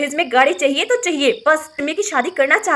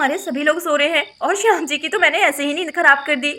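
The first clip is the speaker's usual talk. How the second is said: usual speaking voice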